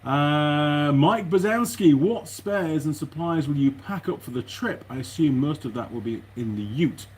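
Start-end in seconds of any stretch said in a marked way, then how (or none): none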